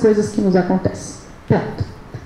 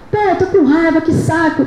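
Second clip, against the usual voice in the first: quick, raised, a bit higher, mildly exasperated; irritated snap; 4 semitones higher than usual. Shown as a demonstration. Speaker demonstrating how an angry person really talks, quick and irritated. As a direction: toss it off impatiently, as if fed up. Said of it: "Fala rápido, fala alto, fala agitado".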